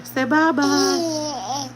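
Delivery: high, very slow sing-song "bye-bye"; drawn-out motherese bye-bye; baby talk. Shown as a demonstration. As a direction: stretch bye-bye long for the baby. Coaxing baby to wave bye-bye.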